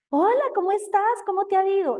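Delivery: demonstrating a cheerful, smiling hello; happy greeting. Shown as a demonstration. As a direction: greet them happily, pitch lifted way up. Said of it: "Lo estoy diciendo feliz".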